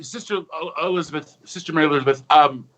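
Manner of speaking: usual speaking voice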